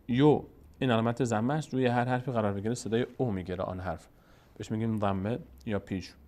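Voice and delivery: normal talking voice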